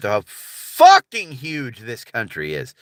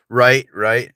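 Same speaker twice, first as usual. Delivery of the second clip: ordinary delivery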